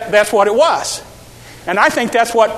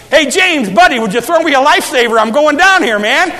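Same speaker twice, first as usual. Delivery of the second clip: higher, louder, fast buddy call; mock panicky call to a fishing buddy; mock casual plea; 5 semitones higher, 5 dB louder than usual. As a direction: ham it up like a panicky pal.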